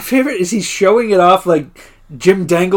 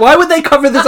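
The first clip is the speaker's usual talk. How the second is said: bitchy; 6 semitones higher than usual. Retold or imitated. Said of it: "he's bitchy about the carpet".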